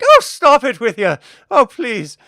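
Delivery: high, loud coy protest; flattered seamstress. Acted. Seamstress flattered by the admiral, loud and coy. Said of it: "She's like".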